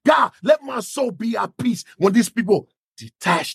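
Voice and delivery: high, shouted anger at God; angry prayer. Acted. Leader venting his rage to God in prayer, shouting. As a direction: cry out your anger to God. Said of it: "I'm mad".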